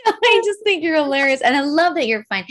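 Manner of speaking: high, loud, laughter-filled words; laughing affection. Real, not acted. Host reassuring her giggling guest, laughing. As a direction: gush it through the tail of a laugh. Reacting amid laughing fit.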